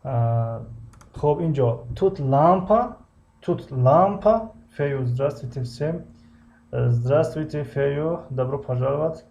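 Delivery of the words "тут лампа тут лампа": slow, higher question with лампа lifted; rising question. Shown as a demonstration. Teacher modelling a German question for students, slow and rising. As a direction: stretch "тут лампа" and let лампа rise. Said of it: "teacher drills new sentence".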